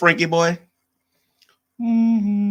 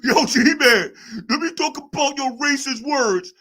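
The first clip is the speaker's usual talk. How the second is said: high, loud mocking voice; high hammed-up street voice; 7 semitones higher, 4 dB louder than usual. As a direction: play the chatter as a sneering tough guy. Mocking a chatter's voice.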